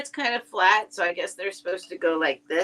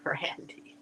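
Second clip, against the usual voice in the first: whispered; 11 dB quieter than usual. Real, not acted. Quiet aside during auction.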